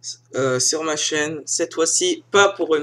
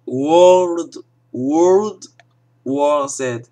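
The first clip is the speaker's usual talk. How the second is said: "World, World War Z" with a louder, overdone English accent, "World" repeated; louder, overdone English-accent try at "World War Z"; hammed-up English accent; 7 dB louder, much slower than usual. Shown as a demonstration.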